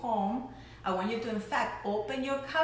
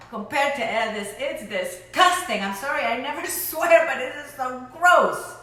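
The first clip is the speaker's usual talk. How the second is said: disgusted; 4 semitones higher, 9 dB louder than usual. Real, not acted. Disgusted rant after tasting.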